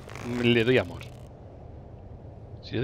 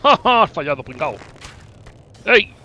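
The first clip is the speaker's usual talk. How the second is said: burla; 3 semitones higher, 7 dB louder than usual. Real, not acted. El enemigo falla, se burla.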